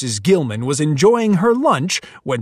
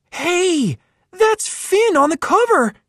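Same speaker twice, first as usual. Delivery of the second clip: excited exclaim. Acted. Johnny spotting Finn on his comic, excited. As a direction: point it out with delight. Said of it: "Johnny exclaimed".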